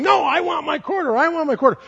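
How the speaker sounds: put-on high, whining childish protest; a four-year-old clinging to her quarter; whiny little girl. A girl in church whining at her parents, high-pitched. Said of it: "as Amanda's going".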